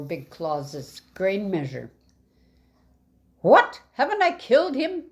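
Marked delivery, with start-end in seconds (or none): 3.4-5.0 high, loud astonished outburst; a sudden loud, high-pitched what; exclaimed. Acted. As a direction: blurt it out in disbelief. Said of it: "Big Claus exclaimed".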